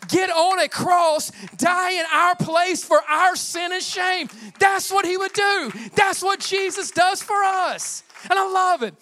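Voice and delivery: loud, high, slowed shouting climax of the sermon; preaching at full force. Acted. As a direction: build it into a booming proclamation. Pastor builds to a shouted climax.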